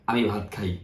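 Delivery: আমি ভাত খাই dropped low and quiet, stress on আমি; teacher quietly repeating the same word order; soft, low repeat. Shown as a demonstration. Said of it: "ওরাও বলে".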